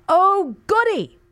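high, slow mock delight; sarcastic glee. Real, not acted. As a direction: cheer with dripping sarcasm. Sarcastic cheer.

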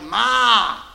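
put-on loud, fed-up shout of a kid at a nagging parent; exasperated kid. Kid snapping back at a parent who repeats, loud and exasperated. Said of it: "you're like".

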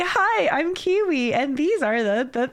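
vanilla presenter voice. Retold or imitated. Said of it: "I did it so vanilla".

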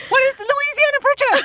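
high, loud eager quiz answer; excited. Retold or imitated. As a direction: blurt it out like a thrilled contestant. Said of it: "I can still see your face getting so excited about it".

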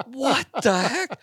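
high, drawn-out incredulous cry; mock disbelief. Hunters looking over as the flock flares, acted-out disbelief. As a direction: stretch it out in high, baffled outrage.

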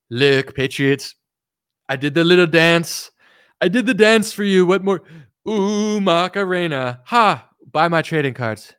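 Trump impression. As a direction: ham up a bragging Trump. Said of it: "Trump will be like".

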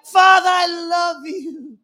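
put-on high, loud, drawn-out child's call; uninhibited childish declaration of love; childlike. Shown as a demonstration. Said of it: "Can you say it like a child to a father?".

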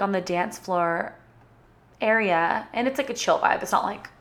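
ordinary talk, nothing marked

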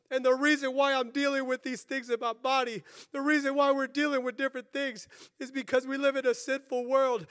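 urgent preacher pleading with God; fervent retold prayer. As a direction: build from earnest plea to fighting resolve. Retold prayer becomes forceful preaching.

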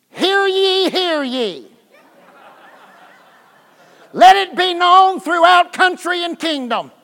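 hammed-up royal proclamation; town crier. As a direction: announce it like a herald. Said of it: "his seven-year-old brain would hear".

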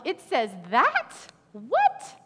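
mock disbelief, rising up on what; incredulous. Preacher voicing a shocked Bible reader, incredulous. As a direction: play the shocked reader of scripture. Congregant voiced, shocked at scripture.

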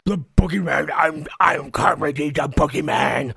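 jowly character voice; Boogeyman. Shown as a demonstration. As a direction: talk as if through heavy, wobbling jowls. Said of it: "I thought jowls".

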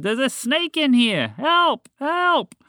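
high, loud cries for help; panicked shouting. A narrator's reading. Trapped frog sees a snake, shouting in panic. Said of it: "Walter shouted".

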